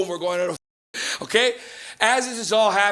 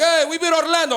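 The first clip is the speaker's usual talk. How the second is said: put-on higher, proud Portuguese dad accent; excited dad; 8 semitones higher than usual. Father telling son about the win, excited accent. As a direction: announce the win like big news. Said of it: "He's like".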